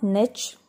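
"niche" as NITCH, short i and CH; wrong pronunciation. Shown as a demonstration. Teacher warning learners off niche as NITCH. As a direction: say "niche" to rhyme with pitch. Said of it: "It is not".

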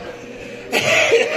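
a burst of high, breathy laughter; high airy laugh. Real, not acted. Pastor laughing to the church at his bold words, high airy laugh. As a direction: laugh at his own nerve.

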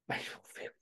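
hushed, barely voiced echo; baffled colleague. Colleague reacting to the dirt request, hushed and baffled. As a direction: imitate a puzzled, near-whispered reply. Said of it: "she was like".

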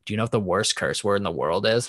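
usual speaking voice

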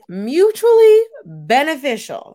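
MUTUALLY stretched long and pitched high; emphasis on mutually. Real, not acted. Reading the next friendship sign aloud, emphasis on mutually. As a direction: sing out "mutually" slowly for emphasis. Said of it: "Emphasis on mutually".

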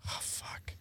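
breathy whispered dismay; whispered oh fuck. Retold or imitated. Watching a grieving relative, hushed whispered dismay. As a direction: breathe it out, barely there. Reacting to a crying cousin.

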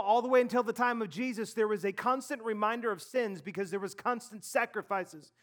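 rushing it all in one breath; running out of air at the end. Real, not acted. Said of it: "I couldn't get all out in one breath".

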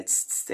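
soft unvoiced hiss like a pressure cooker. Shown as a demonstration. Explaining the Tocantins accent to the interviewer, voiced as a pressure-cooker hiss. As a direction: hiss through the teeth like a pressure cooker's valve. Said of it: "um chiado de panela pressão".

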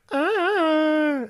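high, long sung-out howl; howling wail. Retold or imitated. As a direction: howl it like a coyote. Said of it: "and I just went".

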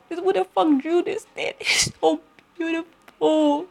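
high, awed squeal with a laugh; gushing in disbelief at a painting; overwhelmed by art. Retold or imitated. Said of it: "I'm like".

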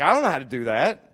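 a king caught off guard, low and rattled; panicked king. Retold or imitated. The king of Israel freaks out over the letter, low panicked voice. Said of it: "The king of Israel freaks out".

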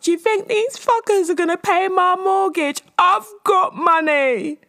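high, loud brash diva outburst, slowing on the end; mock Gemma Collins ranting about her mortgage; imitating Gemma Collins. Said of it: "I felt like her when she was talking to".

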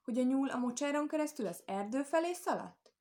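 high, indignant challenge; the storybook wolf's cross questioning; growled accusation. A narrator's reading. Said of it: "mordult rá a farkas".

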